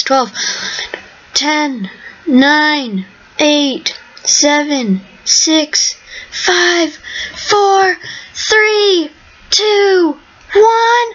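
last seconds of the round, rising excitement; rising countdown. Real, not acted. Final countdown before winning.

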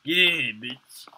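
loud, pushy hostess voice calling them in; mock yelled greeting. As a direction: bellow the welcome like a rowdy cook. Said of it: "Be like".

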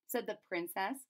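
ordinary delivery

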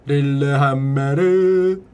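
slow, drawn-out mnemonic intonation. Shown as a demonstration. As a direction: stretch out 릴레 하얀메르, holding the vowels. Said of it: "머릿속에 릴레 하메르 이것만". (음을 길게 끌며 천천히 읊기)

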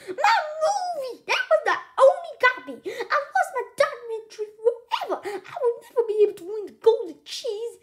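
very high, loud, hysterical lament; anguished wail. A narrator's reading. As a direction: wail as if all is lost. Devastated over the lost movie.

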